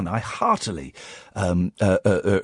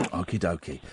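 whispering; 10 semitones lower, 13 dB quieter than usual. Pretend. Host whispers back.

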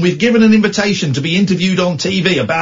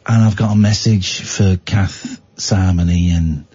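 spooky spirit voice; 12 semitones lower, slower than usual. Retold or imitated. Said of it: "Imagine if he then said".

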